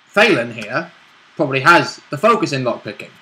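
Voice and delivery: normal talking voice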